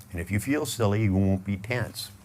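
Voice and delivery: ordinary talk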